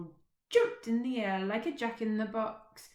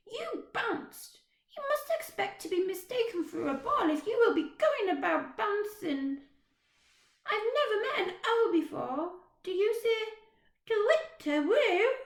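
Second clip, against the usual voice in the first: put-on high, chatty little girl, a bit bossy; 13 semitones higher, 8 dB louder than usual. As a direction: play a cheeky small girl. Little girl's voice in story.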